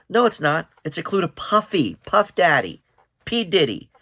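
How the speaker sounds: no, hear me, it's Puffy; emphatic correction. Real, not acted.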